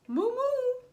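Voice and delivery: high, loud mooing voice; moo, then wish luck in cow voice; talking cows. Acted. Cows send mouse on his way, high mooing farewell. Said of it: "they said".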